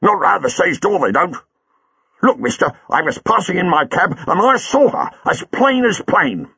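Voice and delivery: a burly cabbie squaring up; cab driver Buller. A narrator's reading. Buller argues with Chang.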